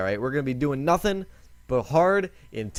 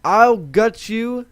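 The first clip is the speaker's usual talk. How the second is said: slow, louder mock threat, higher up; mock shout; 6 semitones higher, 6 dB louder, much slower than usual. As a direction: drag out a hammed-up threat. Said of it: "The woman was shouting".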